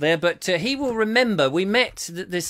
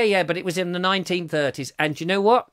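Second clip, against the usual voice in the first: usual speaking voice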